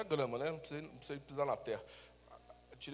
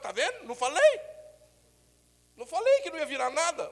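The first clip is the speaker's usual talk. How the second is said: mock gloating; 10 semitones higher, 9 dB louder than usual. Shown as a demonstration. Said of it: "depois fala".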